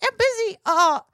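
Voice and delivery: put-on high, chatty little kid voice; little boy voice. Nephew tells his aunt what he's up to, in a high kid voice. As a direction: play a five-year-old wandering the house. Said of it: "he goes".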